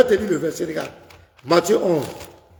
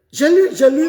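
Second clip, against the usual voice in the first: higher, louder insistence; exasperated; 9 semitones higher, 4 dB louder than usual. Real, not acted. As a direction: protest it, fed up. Heated phone argument.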